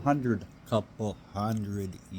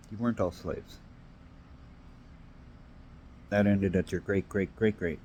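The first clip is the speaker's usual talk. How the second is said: ordinary talk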